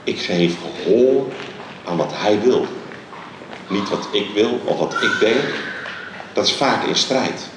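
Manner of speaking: ordinary delivery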